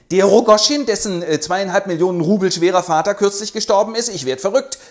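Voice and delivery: loud, high, amazed outburst; astonished exclamation. Acted. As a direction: blurt it out in disbelief. Lebedew staunt über den reichen Erben.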